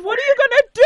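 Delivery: high and loud, keen to know; eager question. Real, not acted. Host asking the winner her plans, high and eager. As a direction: fire the question out with excitement.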